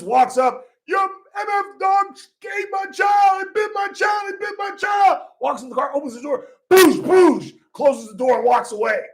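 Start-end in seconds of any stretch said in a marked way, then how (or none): 0.9-5.3 playing the father yelling at the dog's owners; furious yell. Father confronts neighbors, retold. 6.7-7.6 loud, high mouth-made gunshot booms; gunshot noises. Retold or imitated. Imitating gunshots in story.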